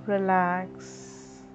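ordinary delivery

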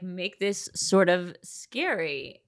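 normal talking voice

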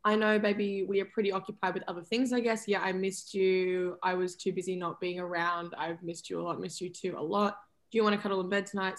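low, soft lovers' murmur; tender couple's talk. Acted. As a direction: voice both partners gently and low. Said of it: "this is our conversation".

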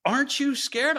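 hammed-up frost spirit asking sweetly; Morozko. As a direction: tease the girl with the question. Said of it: "and he goes".

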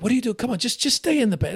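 mock irritated wife; imitating wife. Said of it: "my wife's like".

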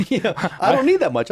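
high, fast, laughing. Real, not acted. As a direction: laugh while talking. Laughs at big heal.

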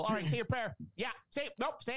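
high, pushy mock demand; confrontational. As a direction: needle them, daring and sharp. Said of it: "sounding like you're confrontational too".

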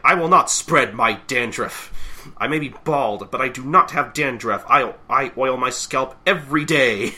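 loud, emphatic protest; mock-outraged, punched words; offended fire chief. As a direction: defend your dignity indignantly. Chief acted offended in quarrel.

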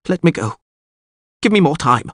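high-pitched begging; anxious pleading. A narrator's reading. Bound lord begs the witcher for release, pleading. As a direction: beg urgently for your life.

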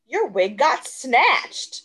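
slow, high, drawn-out mock-kid tease; imitated child's jibe. Tutor quoting her eleven-year-old student teasing her, imitated jibe. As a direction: stretch it out like a kid delivering a burn.

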